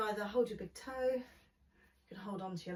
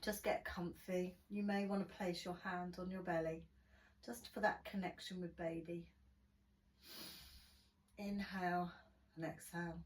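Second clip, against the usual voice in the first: guided relaxation; 7 dB quieter than usual. Acted. Guided relaxation closing the class.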